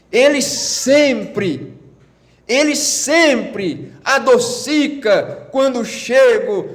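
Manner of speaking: slow, high, drawn-out pulpit delivery; fervent, measured proclamation; impassioned preaching. Acted.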